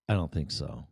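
low, quiet refusal; understated, unwilling aside; deadpan refusal. Retold or imitated. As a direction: drop it low and soft, having none of it. Balking at riding the bridge.

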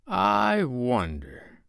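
very slow, drawn-out musing; high start falling slowly into thought; thoughtful. A narrator's reading. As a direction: ponder it doubtfully, stretching the words. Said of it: "Mister Darling said thoughtfully".

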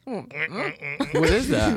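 slow, high and laughing. Real, not acted. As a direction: ask it while cracking up. Weird noise interrupts podcast.

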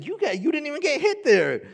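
a daddy teasing his toddler, high mock disbelief; playful disbelief. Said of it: "I said".

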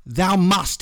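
mildly theatrical demand; mock yell. A narrator's reading. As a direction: narrate a yell at normal volume. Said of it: "he yelled".

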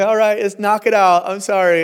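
high, loud, overly agreeable dad; mock eager dad. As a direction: play a dad cheerfully agreeing to revenge. Dad agrees to tooth revenge.

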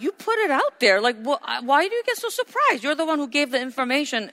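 high-pitched, incredulous mock scolding; exasperated retort. Acted retort to shocked people.

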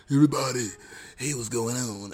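put-on a first try at an old man that misses; barely changed greeting, not yet old; failed old man voice. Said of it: "that's not really old man voice".

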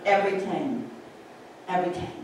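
put-on repeated reminder in a Dutch accent, dying away; Dutch-accented admonition, trailing down. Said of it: "She kind of repeated".

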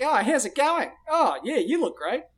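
sneering country Australian greeting; country Australian accent. Shown as a demonstration. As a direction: push Australian vowels up behind the nose. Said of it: "it sounds like this".

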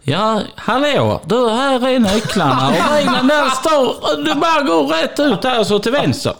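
high, slow imitated Skåne clerk; friendly clerk, mock southern lilt; laughing imitation of a cheerful clerk; car rental clerk. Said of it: "Och han kommer fram".

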